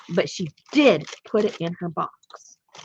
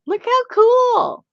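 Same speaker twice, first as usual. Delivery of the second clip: high, loud, drawn-out gushing; excited admiration; 10 dB louder, much slower than usual. Real, not acted. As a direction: stretch it out in loud, high delight. Admiring a favourite picture.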